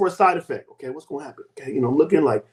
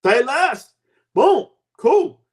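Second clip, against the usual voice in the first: excited celebration; 8 semitones higher, 5 dB louder, much slower than usual. Real, not acted. Celebrating getting off medication.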